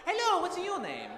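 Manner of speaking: high, bouncy character greeting; show-floor greeter voice. Acted. Red man greets visitors on a show floor, high character voice. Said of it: "like, how he got his job".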